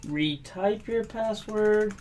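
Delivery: ordinary talk, nothing marked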